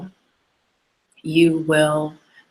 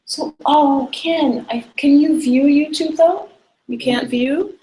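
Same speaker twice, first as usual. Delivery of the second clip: much higher-pitched, surprised questioning; calling to friend; 11 semitones higher than usual. Real, not acted. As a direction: ask someone off camera in a high, lifted voice. Calls to off-camera friend Ken.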